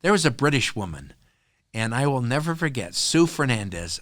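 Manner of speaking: ordinary delivery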